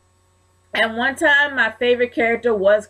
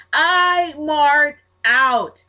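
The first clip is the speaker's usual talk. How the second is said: high, drawn-out exclamation, very slow; fan excitement; 6 semitones higher, 4 dB louder, much slower than usual. Real, not acted. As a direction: stretch each word in elated emphasis. Fan elated at a win.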